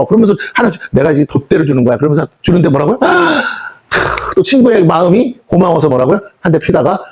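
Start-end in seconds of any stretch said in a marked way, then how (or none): 3.0-3.7 sharp high gasp of delight; mock gasp. Friend offers last cigarette, mock gasp. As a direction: gasp as if handed a treasure.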